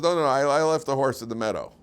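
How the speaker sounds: a lightly voiced Bilam, flustered; flustered denial. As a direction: bluster like a caught liar.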